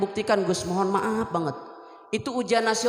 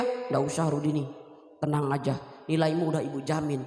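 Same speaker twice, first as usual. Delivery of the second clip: reassuring teacher; 8 semitones lower, 4 dB quieter than usual. Retold or imitated. Teacher reassures the worried student.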